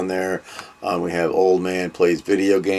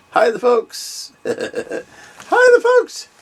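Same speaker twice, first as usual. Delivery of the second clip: very high squeaky voice for the dog; silly voiced-over pet greeting; dog's voice. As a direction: answer for the pup in a tiny high voice. Speaks for dog to viewers.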